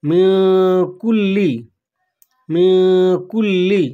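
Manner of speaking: মিউ nasal and long, then কুল্লি with a held ল; nasal then doubled l. Shown as a demonstration. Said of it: "নুনটা গুণনা হবে". (নাকে টেনে মিউ, জোরে কুল্লি)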